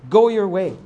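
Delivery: emphatic, authoritative command. Retold or imitated. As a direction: hand it down as an order. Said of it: "That's the command".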